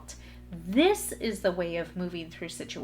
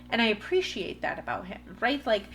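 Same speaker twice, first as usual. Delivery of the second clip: ordinary talk, nothing marked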